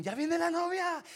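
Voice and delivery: high, bright-eyed call of the guests; excited announcement. Retold or imitated. Wedding guests announcing the bride, high and excited.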